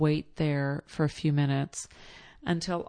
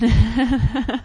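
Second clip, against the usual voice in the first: loud laugh; 6 semitones higher, 8 dB louder than usual. Real, not acted. Laugh after surprise.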